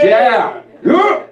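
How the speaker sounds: high whooping yeahs; excited cheer. Retold or imitated. Friends cheering the song to mom, excited. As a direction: cheer it like a fan.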